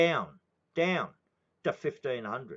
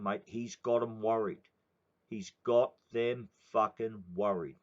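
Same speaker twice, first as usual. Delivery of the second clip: normal talking voice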